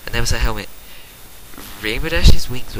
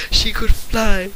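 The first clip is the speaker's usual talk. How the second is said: much higher and louder, thrilled; excited; 10 semitones higher, 4 dB louder, much slower than usual. A narrator's reading. Narrator sharing the filly's first flight, excited and high. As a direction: burst out with joy.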